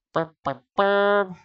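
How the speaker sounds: sung-out "dun dun dun" suspense noise; mock dramatic sting. Narrator mocking the chapter's cliffhanger, melodramatic sting.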